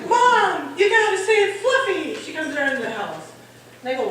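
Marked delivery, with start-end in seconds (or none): 0.1-2.1 high, loud plea to Mom; alarmed child's holler; urgent child. Retold or imitated. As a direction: imitate a kid crying out for help. Child urgently calling mother for cat.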